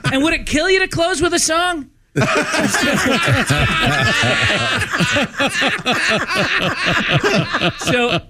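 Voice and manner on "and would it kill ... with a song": high, exasperated comic scolding; hammed-up old grouch's rant; angry yelling rant. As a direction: scold the young comics in mock fury.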